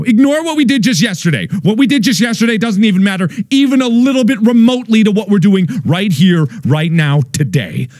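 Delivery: fervent rallying cry. Acted.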